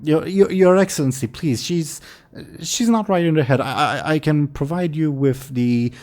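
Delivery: nervous soldier begging her lord; flustered pleading. Acted.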